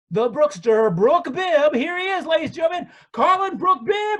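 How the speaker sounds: big booming ring announcer intro; high, loud showman hype; announcer shout. Acted.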